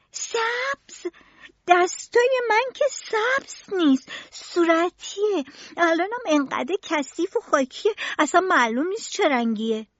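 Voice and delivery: put-on high-pitched boy's disbelief; astonished cry. A narrator's reading. Boy protesting his hands aren't green, astonished. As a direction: exclaim very high, without real shouting. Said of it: "تیستو با تعجب فریاد زد".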